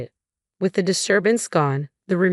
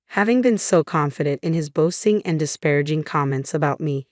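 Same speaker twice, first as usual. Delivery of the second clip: usual speaking voice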